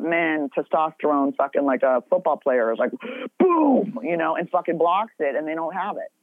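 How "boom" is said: sharp gasp into a higher mock impact; gasp and boom. Testosterone slamming in like a football player, mock boom. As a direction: hit it like a tackle.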